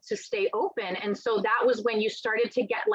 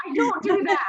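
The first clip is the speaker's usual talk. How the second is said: loud, high protest that she doesn't; mock-insistent no, louder; emphatic denial; 7 semitones higher, 4 dB louder than usual. Author denying to host she casts friends, loud mock insistence.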